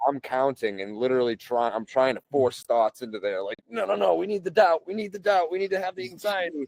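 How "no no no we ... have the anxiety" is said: much higher, panicky voice of her anxious brain; mock frantic inner voice. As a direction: play the frantic part of the mind pleading.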